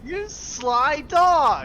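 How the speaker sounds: slow, loud, high sly dog; loud mock drawl. Friend quoting the merc, loud and slow.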